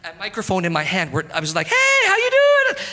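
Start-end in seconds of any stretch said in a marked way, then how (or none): 1.7-2.9 high, slow, sugary mock greeting; high drawn-out fake cheer; fake friendly greeting. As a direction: put on a fake-friendly hello. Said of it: "Acting like you're my best friend".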